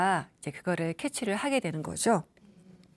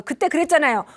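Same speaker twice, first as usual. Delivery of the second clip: loud, higher reproach; accusing grown child; 5 semitones higher, 7 dB louder than usual. Retold or imitated. Grown child confronting parents about the past, loudly accusing. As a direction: throw old hurts back at parents. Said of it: "엄마하고 막 또는 아빠하고".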